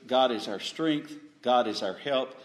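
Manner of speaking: ordinary talk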